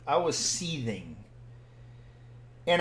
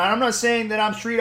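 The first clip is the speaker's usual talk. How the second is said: normal talking voice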